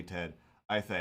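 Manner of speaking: ordinary delivery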